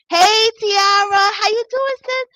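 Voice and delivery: very high, gushing fake-friendly hello; mock cheery greeting. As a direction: put on an over-sweet sing-out greeting.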